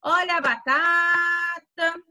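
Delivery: high, long-drawn street seller's call; market vendor's cry. Acted. Market seller calls out potatoes in a drawn-out vendor cry. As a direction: hawk it, stretching the middle of batata. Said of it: "Quando o feirante fala".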